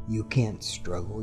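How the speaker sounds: normal talking voice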